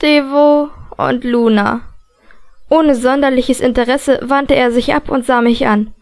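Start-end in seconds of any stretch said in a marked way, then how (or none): 0.0-1.8 slow, uninterested repeat; bored tone. A narrator's reading. Matthew echoing Selena's introduction, slow and bored. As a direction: toss the names back with no interest. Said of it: "In gelangweiltem Ton wiederholte Matthew".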